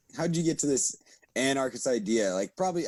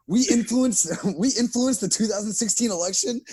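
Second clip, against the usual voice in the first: mock disbelief, higher pitched; raised-pitch, questioning amazement, played for laughs; incredulous Putin; 7 semitones higher than usual. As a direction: act out a baffled leader reading the news. Putin reacting to news, imagined.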